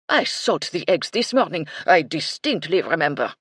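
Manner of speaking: loud, insistent French chef; indignant French chef; French accent. A narrator's reading. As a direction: protest in a huffy French accent. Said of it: "Michel's French accent".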